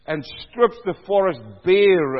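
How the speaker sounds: loud, measured psalm reading; booming scripture proclamation; proclaimed scripture. Acted. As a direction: declaim it to the congregation.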